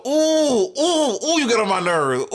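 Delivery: put-on high, drawn-out childish oohs; mock little-kid annoyance; kid's whine. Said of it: "you know when kids go".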